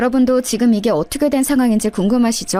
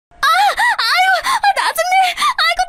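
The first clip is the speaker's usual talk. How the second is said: very high screams of pain; pained screaming. Acted. Sister-in-law screams at the narrator while being beaten, very high. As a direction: scream as the blows land.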